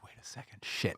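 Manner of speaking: whispered, dawning dread; under your breath, realizing; whispered realization. Retold or imitated. Man realizing he brought his girlfriend, whispered.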